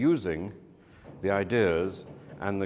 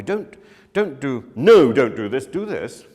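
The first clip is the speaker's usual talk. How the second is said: 1 director commands; 4 semitones higher, 11 dB louder than usual. Retold or imitated. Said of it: "someone told me".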